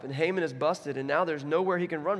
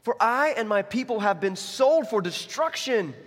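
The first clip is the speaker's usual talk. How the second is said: slow, rising, loud declaration; forceful, drawn-out plea; impassioned appeal; 7 semitones higher, 6 dB louder, slower than usual. Acted. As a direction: build louder and higher, hammering each dreadful word. Acts Esther's impassioned accusation to king.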